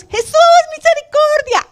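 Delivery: high imploring cry of a leper; pleading for mercy. Retold or imitated. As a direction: plead for mercy, reaching upward. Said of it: "dice la palabra de Dios". (súplica aguda pidiendo misericordia)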